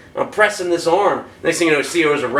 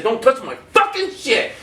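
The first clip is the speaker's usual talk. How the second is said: high, emphatic repeat of a threat; retold angry warning; 7 semitones higher than usual. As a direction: lay down the law, punching FUCKING.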